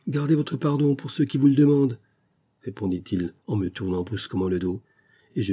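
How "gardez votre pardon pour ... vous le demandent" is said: proud refusal. A narrator's reading. Son rejects father's pardon.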